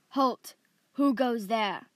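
loud challenging call from a distance; guard's challenge. A narrator's reading. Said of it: "a voice came up from behind some trees".

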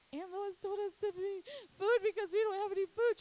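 high, loud, blubbering sobs; hammed-up tearful blurting, wailing the words; imitated crying wail. Crying daughter explaining the food to mom, hammed-up wail. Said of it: "I started crying".

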